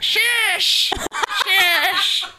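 put-on high sharp shush like a stern old lady; librarian shushing whisper. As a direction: shush them like a cranky old woman. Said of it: "It was like an old lady from the West".

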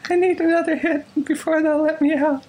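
high, plaintive trapped voice; mock-pitiful high plea; creepy voice. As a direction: plead in eerie falsetto from inside a machine. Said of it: "who am I doing an impression of".

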